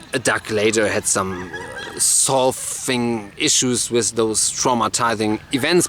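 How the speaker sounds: ordinary delivery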